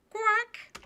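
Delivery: high squawked quack; duck quack. Acted. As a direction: answer as an actual duck. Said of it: "why is my dog voice so loud and grating?".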